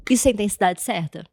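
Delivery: ordinary talk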